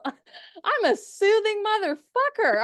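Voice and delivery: high, slow, hammed-up delight; joking self-admiration. Admiring her own recorded voice, hammed-up delight. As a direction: stretch it out high, jokingly smitten with yourself. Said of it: "partially joking".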